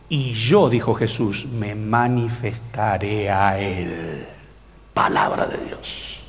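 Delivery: very slow, low, reverent close; hushed scripture reading. Acted. Preacher ends Jesus's promise to young people, slow hushed reverence. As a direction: let the verse sink into a hush.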